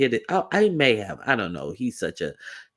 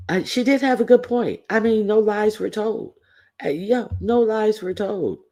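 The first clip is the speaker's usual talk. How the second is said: high mock agreement; sarcastic; 10 semitones higher than usual. Real, not acted.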